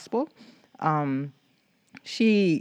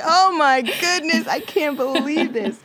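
high, slow, hammed-up disbelief; mock shock; 11 semitones higher, 4 dB louder, slower than usual. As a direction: ham up the horror at the sign. Shocked reaction retold laughing.

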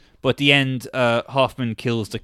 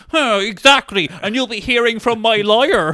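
sputtering foiled villain; 7 semitones higher, 4 dB louder than usual. Retold or imitated. Accused man gloats.

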